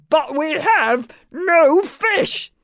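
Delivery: slow, high, measured dragon-speak; forced-pleasant firmness, word by word; firm but pleasant. A narrator's reading. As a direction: spell it out slowly, firm yet polite. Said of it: "tried to make his voice sound firm but pleasant".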